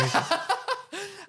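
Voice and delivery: squealing laugh; high burst of laughter. Real, not acted. Loud laugh.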